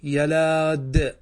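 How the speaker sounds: يلاد stretched very slowly, every sound held; teacher dictating يلاد slowly; slow drawn-out word. Shown as a demonstration. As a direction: draw out يلاد for the class. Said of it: "نقول".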